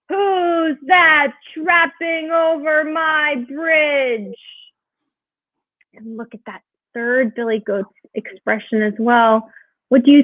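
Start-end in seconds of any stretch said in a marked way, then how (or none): 0.1-4.4 high, slow troll roar. A narrator's reading. Troll challenging the biggest goat, roaring. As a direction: stretch every word as an outraged storybook troll. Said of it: "the troll roared out from under".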